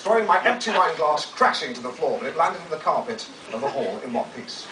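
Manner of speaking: usual speaking voice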